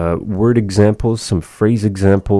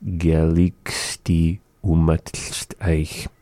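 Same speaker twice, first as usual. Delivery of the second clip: slow modeling; much slower than usual. Shown as a demonstration. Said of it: "teacher models phrase slowly".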